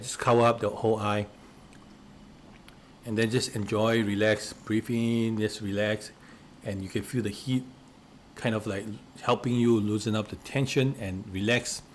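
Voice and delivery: calm instructor coaxing the class to unwind; soft relaxation guidance. Acted. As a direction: ease them into letting go. Guides a relaxation exercise.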